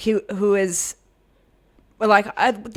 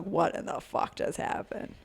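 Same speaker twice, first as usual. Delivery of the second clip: whispered; 8 dB quieter than usual. Retold or imitated. Room stunned after sudden knockout.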